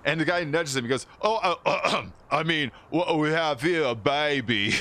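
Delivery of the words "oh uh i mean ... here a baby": put-on slips into a higher American accent, correcting himself; mock American thug, flustered. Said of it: "He used this American accent".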